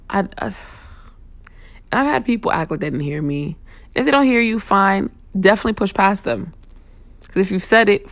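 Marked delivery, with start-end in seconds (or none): none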